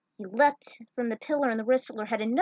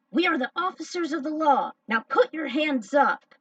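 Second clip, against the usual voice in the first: stern police officer; 6 semitones higher, 5 dB louder than usual. Acted. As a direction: bark it like a cop making an arrest. Police officer confronting the heroes.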